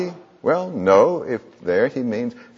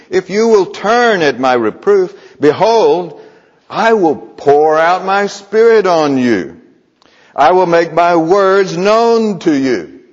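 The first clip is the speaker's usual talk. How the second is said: louder, higher, slower, proclaiming God's promise; emphatic scripture proclamation; 6 semitones higher, 6 dB louder than usual. Acted. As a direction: ring out the verse like a herald. Quotes God's promise with force.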